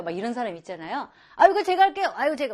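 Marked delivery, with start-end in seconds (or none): 1.4-2.5 high, loud eager offer; eager volunteer. Retold or imitated. Teacher acting an eager helper, keen. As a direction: jump in to volunteer.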